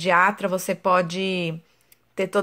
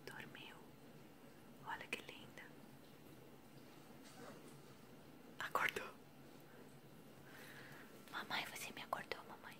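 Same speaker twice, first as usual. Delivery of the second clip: whisper; 32 dB quieter than usual. Real, not acted. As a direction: breathe the words, no voice at all. Baby falling asleep nearby.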